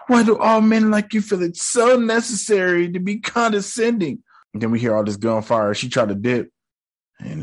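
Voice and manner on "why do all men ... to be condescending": mock her high and prim; mocking Layla. Reacting to Layla scolding Harrow, high mocking voice. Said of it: "And she said".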